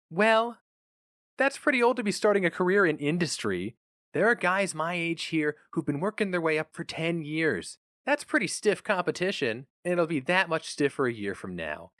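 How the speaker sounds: a gentle hint of a fretting wife; worried wife. A narrator's reading. Nan on the phone questioning her husband's choice, worried.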